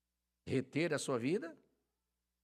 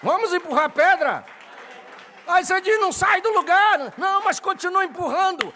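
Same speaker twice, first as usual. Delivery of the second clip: loud, high fiery sermon climax; booming pulpit preaching; preaching at full force; 12 semitones higher, 12 dB louder than usual. Acted. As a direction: rouse the congregation at full voice.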